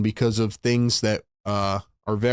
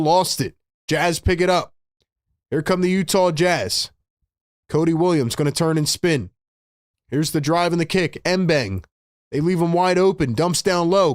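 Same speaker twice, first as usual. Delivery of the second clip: brisk, raised play-by-play call; live play-by-play; 5 semitones higher than usual. Acted. Fan streamer calling the Sixers game for chat, play-by-play. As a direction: call the action like a broadcaster.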